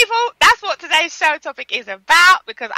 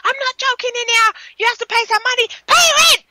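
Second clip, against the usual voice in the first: high mock tirade, building louder; imitated mad mother; 12 semitones higher, 3 dB louder than usual. Mimicking a mother demanding rent money, mock furious. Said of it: "she was going mad".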